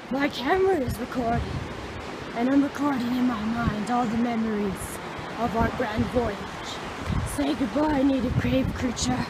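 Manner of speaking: loud, grand documentary host; mock tour guide. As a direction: announce the trek like an explorer. Continues grand tour narration.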